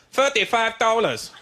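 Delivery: put-on slow, clipped Chinese shopkeeper accent. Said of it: "He goes".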